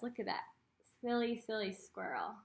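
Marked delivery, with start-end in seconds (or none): none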